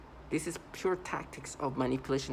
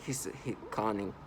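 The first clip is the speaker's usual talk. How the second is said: lightly imitated analytical verdict, lowered and softened; voice of logic; 4 semitones lower, 5 dB quieter than usual. Mimicking perps' reply to logic, in a mock-analytical voice. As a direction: pass a mock-logical judgement. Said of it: "By using a voice of logic, of analyticalness".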